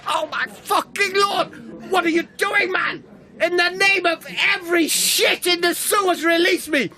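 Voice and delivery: hammed-up yells of agony, crying out; pained shouting. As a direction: scream at him to let go. Hand crushed by chin.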